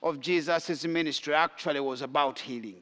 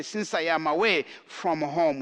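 ordinary talk

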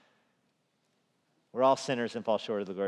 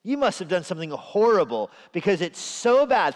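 accusing friend of Job; 6 semitones higher, 6 dB louder than usual. Retold or imitated. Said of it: "Job's friends come to him and say".

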